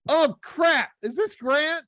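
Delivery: high-pitched retold shock; stunned realization. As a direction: blurt it out, caught off guard. Realizes the famous caller's identity.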